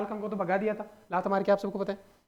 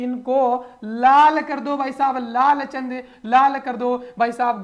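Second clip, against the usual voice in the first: slow booming order; 2 semitones higher, 8 dB louder, slower than usual. Acted. Teacher voices Pratap ordering his men, slow loud command.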